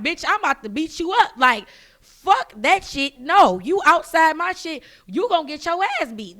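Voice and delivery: loud, punched-out retold threat; fired up, refusing to be calmed; heated threat. Retells own angry threat to visitor.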